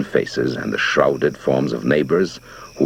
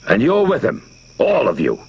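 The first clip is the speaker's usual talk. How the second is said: raised higher voice flung at the crowd; bellowing; 10 semitones higher, 4 dB louder than usual. A narrator's reading. Steve bellows at the backing-away crowd, raised voice. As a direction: call out the whole crowd. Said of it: "Steve bellowed at them".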